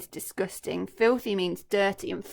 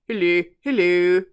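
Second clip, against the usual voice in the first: hippo greeting; 2 semitones lower, 11 dB louder than usual. Acted.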